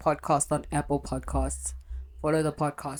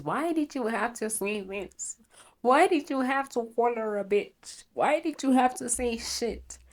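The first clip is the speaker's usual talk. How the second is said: higher nagging mom voice, mock scolding; whiny mock-mother, higher pitch; imitating mom; 7 semitones higher than usual. Mom scolding her daughter about the podcast, imitated nagging voice. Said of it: "She always says".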